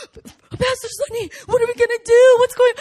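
high, loud mock panic; panicked congregant. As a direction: play the freaked-out church member. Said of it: "some of our people are like".

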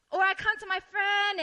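normal talking voice

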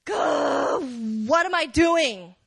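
acted cry of exasperation; exasperated self-questioning. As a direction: groan it out in frustration, drawing out the words. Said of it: "in that moment, you're thinking".

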